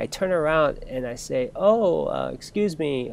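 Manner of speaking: ordinary delivery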